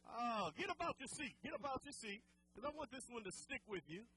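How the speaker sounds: normal talking voice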